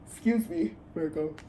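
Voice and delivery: talking through a yawn. Real, not acted. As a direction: yawn mid-word. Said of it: "excuse my yawning".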